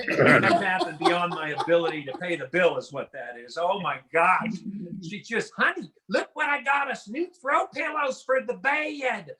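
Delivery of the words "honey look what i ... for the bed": put-on Southern accent. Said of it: "That's a two-syllable word".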